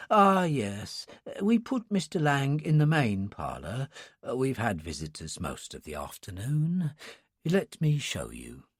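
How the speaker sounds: put-on soft, hushed old attendant; a sleepy old man whispering politely; quiet old man voice.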